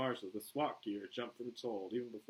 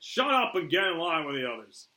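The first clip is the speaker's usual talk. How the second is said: raised, forceful command; stern loud order; 7 semitones higher, 12 dB louder than usual. Acted. Flag puts Boomer in line, stern shouted order. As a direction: order it out sharply and loudly.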